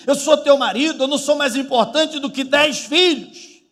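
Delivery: high, loud exasperated rant; acted-out marital outburst; fed-up husband's scolding. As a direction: scold your wife as if you've had enough.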